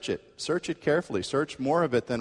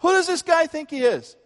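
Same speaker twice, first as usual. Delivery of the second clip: much higher and louder, outraged and a little afraid; an offended commuter's shrill protest; indignant outrage; 7 dB louder than usual. Retold or imitated. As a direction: demand who he thinks he is. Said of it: "She was indignant".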